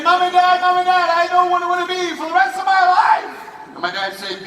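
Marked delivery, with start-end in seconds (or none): 0.0-3.3 high, fast, loud shout; breathless-eager yell to the parents; excited announcement. Retold or imitated. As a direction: burst in bubbling over with news. Son announces his dream to parents.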